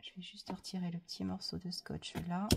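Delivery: whispered aside while peeling tape. Real, not acted. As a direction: whisper it to yourself.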